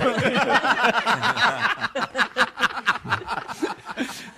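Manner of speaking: long, high peal of laughter; real laughter. Real, not acted. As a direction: keep laughing, high and helpless. Laughing at the joke set-up.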